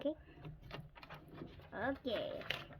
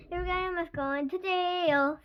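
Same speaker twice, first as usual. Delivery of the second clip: slow, raised sing-out taunt; 6 semitones higher, 6 dB louder, slower than usual. A narrator's reading. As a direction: tease loudly, drawing it out. Max taunts Chloe.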